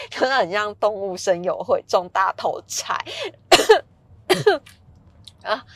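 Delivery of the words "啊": sudden coughing fit, caught off guard; choking on saliva. Real, not acted. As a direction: cough and choke on spit. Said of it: "因为被口水呛到".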